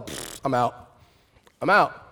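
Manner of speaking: dismissive scoff. Retold or imitated. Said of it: "I was all".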